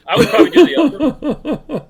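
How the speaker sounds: laughing. Real, not acted. Joking answer, laugh heard.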